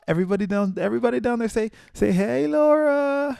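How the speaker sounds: mock hype-up shout; playful rallying call. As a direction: get everyone hollering hello, rising to a long high yell. Rallying viewers to greet Laura.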